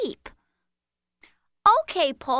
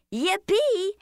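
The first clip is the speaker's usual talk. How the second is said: excited yell; 5 semitones higher than usual. Acted. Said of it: "Yolanda was yelling".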